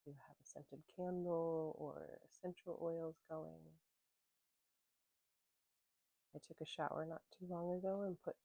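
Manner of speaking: calm, unhurried voice with long pauses; gentle grounding-practice guide; guided meditation. Real, not acted. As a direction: ease listeners into their senses, letting phrases settle and fade.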